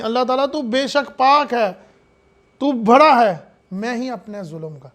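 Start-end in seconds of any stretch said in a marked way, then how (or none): none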